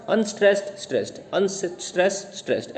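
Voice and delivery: Unstressed, stressed chanted as alternating beats; alternating stress pattern. Shown as a demonstration. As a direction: swing between unstressed and stressed like a meter. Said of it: "ऐसे चला जाता है". (Unstressed, stressed बारी-बारी लय में)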